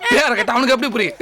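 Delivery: ordinary talk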